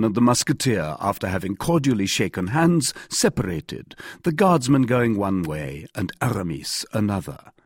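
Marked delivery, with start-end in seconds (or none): none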